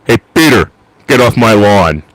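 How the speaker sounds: loud, booming cartoon neighbor; Patrick Warburton. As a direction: call out forcefully to chase someone off the lawn. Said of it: "from the guy that plays, uh, Patrick Warburton".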